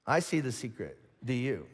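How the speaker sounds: slow, low and hushed toward the end; a quiet, measured divine aside; lightly voiced God. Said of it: "what God is calling out here is".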